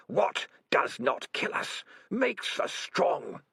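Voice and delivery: slow eerie whisper-like voice; drawn out, cold and sinister; eerie Spider voice. A narrator's reading. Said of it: "said the voice of Spider".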